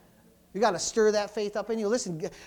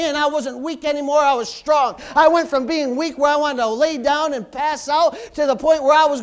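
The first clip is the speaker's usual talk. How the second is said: loud, driving sermon peak; preacher at full cry; full-force preaching; 5 semitones higher, 9 dB louder than usual. Real, not acted. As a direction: shout it out with fire from the pulpit.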